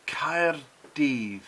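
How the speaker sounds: "Caerdydd" with the final dd as a thick th; Welsh pronunciation. Shown as a demonstration. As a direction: end "Caerdydd" on a voiced th. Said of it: "double D was like a thick T H sound".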